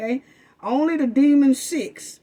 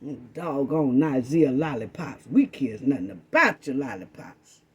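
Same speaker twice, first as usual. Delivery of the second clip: low, mock-grumbling voice of the other side; lightly voiced mock of rivals; mocking imitation; 8 semitones lower than usual. Mimicking the other camp's followers, lowered mocking voice. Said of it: "Talking about".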